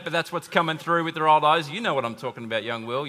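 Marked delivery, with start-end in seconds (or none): none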